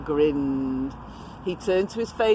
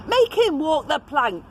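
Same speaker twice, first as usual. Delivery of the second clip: excited pirate demand; 9 semitones higher, 3 dB louder than usual. Acted.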